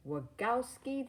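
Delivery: high, mocking call of the name; a creepy monster taunting Mike. A narrator's reading. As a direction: sneer the name out, teasing. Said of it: "the monster said mockingly".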